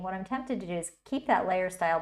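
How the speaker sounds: ordinary delivery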